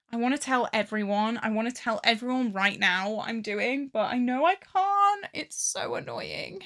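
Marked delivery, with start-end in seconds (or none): 3.9-5.3 frustrated. Real, not acted. Frustrated secret. 5.3-6.6 unvoiced, slow whispered grumble; whispered annoyance. Real, not acted. Frustrated aside.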